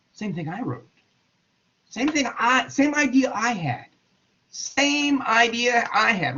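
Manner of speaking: high-pitched mock disbelief, stretched out at the end; astonished dismay. Darwin reads Wallace's letter with his own idea, high mock disbelief. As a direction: squeak it in stunned realisation.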